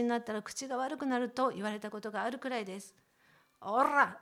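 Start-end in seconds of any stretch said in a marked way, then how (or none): 3.6-4.2 おら！ with a hard rolled r. Shown as a demonstration. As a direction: roll the r in おら！ loudly. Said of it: "巻き舌の練習".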